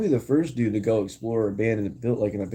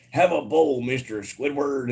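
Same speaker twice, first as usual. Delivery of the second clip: Mr. Krabs; 4 semitones higher, slower than usual. Retold or imitated. Streamer acting out a meme for chat, high cartoon impression. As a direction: belt it out like a cartoon captain, last word drawn out. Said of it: "Krabs is like".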